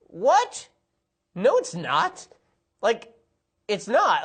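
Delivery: high-pitched, disbelieving squawk; exasperated, high protest; incredulous outburst. Real, not acted. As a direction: shoot up high in sheer disbelief. Outraged reply to film's line.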